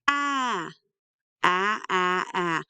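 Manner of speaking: "Ah" as the short a of hat, held; separate, drawn-out short a sounds, "Ah"; short vowel demo. Shown as a demonstration. Teacher shows what A says, isolated short "Ah". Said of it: "A says".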